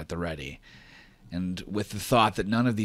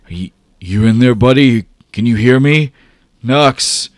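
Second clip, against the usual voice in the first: higher, loud, worried call to a friend; Pusher calling out; 6 semitones higher, 18 dB louder than usual. Acted. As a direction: reach him through the fog, calling loud. GM voicing Pusher calling to Nux.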